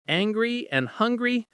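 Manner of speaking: plain reading voice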